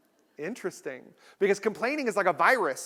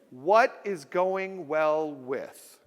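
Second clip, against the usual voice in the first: very slow, evenly spaced phrase; slow drill; 3 semitones lower, much slower than usual. Shown as a demonstration. Speaker teaching the audience the phrase, slow. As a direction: say it slowly for the crowd to echo. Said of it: "Everybody say it with me".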